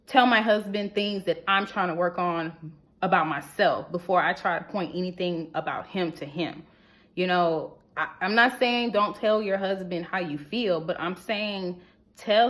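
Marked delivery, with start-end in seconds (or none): none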